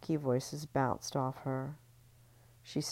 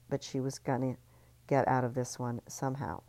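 normal talking voice

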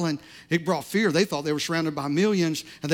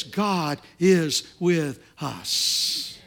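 slow emphasis; 4 semitones lower, much slower than usual. Acted.

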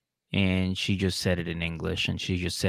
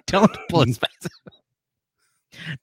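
laughing; 7 semitones higher, 4 dB louder, slower than usual. Real, not acted. Laughing while urging.

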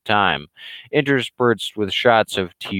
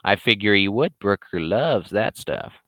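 usual speaking voice

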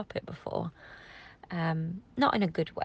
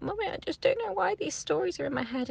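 put-on high child voice, wistful; little daughter; 12 semitones higher than usual. Mother retelling her daughter's worry to her mum, high child voice. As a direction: voice a small girl telling mummy her worry. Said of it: "and then she just got".

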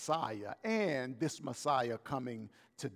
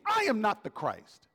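mock scream, raised pitch without full volume; John; 5 semitones higher than usual. Preacher imitating John screaming his denial, a mild mock scream. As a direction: cry out the denial higher, a restrained scream. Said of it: "Like he's screaming".

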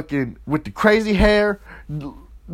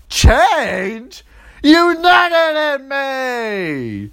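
high, loud, drawn-out mock screech; outraged yell; 9 semitones higher, 4 dB louder, much slower than usual. Imitating pregnant girlfriend told to change, outraged yell. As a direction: wail the accusation out long and high.

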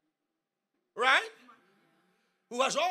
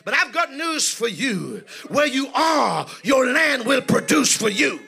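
preaching at full force; 9 dB louder than usual. Acted. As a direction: proclaim it to the congregation at full voice.

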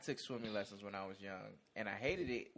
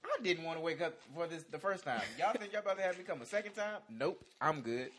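indignant refusal; 7 semitones higher, 6 dB louder than usual. Retold or imitated. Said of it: "I'm like".

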